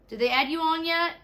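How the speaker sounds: loud, high question with rising end; calling out. Real, not acted. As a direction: holler a question across the house. Calls to child in another room.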